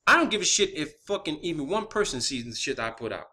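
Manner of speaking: normal talking voice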